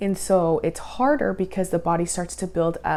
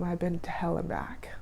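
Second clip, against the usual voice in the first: hushed whisper of hard times; 7 dB quieter than usual. Real, not acted. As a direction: breathe it out like a secret. Solemn confession to viewers.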